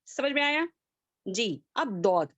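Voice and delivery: ordinary delivery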